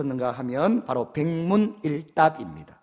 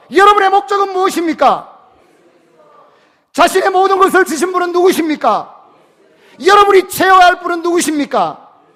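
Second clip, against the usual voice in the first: much higher and louder preaching shout; shouted call to the congregation; 14 semitones higher, 15 dB louder than usual. Real, not acted. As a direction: hurl each question at the whole room. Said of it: "저도 한번 테스트 해보겠습니다".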